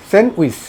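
স্যান্ডউইচ as "sen-wis", silent d; correct pronunciation. Shown as a demonstration. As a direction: drop the d from স্যান্ডউইচ. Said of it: "সঠিক উচ্চারণ হলো এটা".

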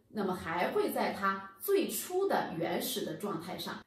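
ordinary talk

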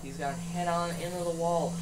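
ordinary talk

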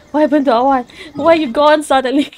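playful imitation of her own confused reaction; retold surprise. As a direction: act out baffled surprise at a friend vanishing. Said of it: "I was like".

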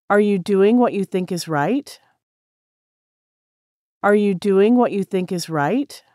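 normal talking voice

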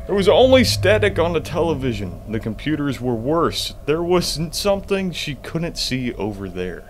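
reading the note to viewers, a bit dramatic; reading a found letter aloud. Shown as a demonstration. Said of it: "Another letter".

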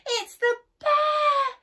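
very high, loud, stretched alarm; frightened cry. A narrator's reading. Boy warns mum about the bear, crying out. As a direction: cry out in terror. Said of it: "cried Eddie".

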